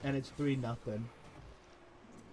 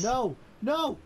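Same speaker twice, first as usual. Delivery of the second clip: protesting shout; 8 semitones higher, 4 dB louder than usual. Real, not acted. Friend leaving for Pop-Tarts.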